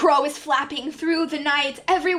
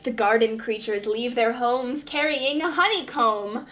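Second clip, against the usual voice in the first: ordinary delivery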